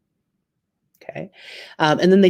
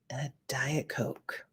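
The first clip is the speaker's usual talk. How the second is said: sneak it in under the breath; whispered, a little guilty; whispered aside; 4 semitones lower, 17 dB quieter than usual. Acted. To the saleslady, whispering the diet order.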